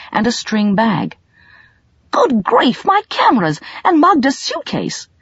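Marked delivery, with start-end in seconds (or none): 2.1-5.1 higher, startled exclamation; dismayed gasp. A narrator's reading. Colin realising he forgot the luggage, startled. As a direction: blurt it out, suddenly remembering. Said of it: "he gasped".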